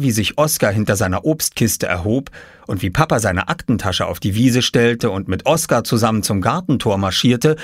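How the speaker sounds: ordinary delivery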